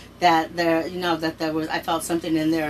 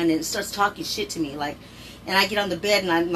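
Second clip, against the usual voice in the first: ordinary delivery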